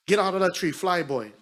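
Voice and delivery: put-on loud command to a boy; strict auntie order. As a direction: shout the kid down from the tree.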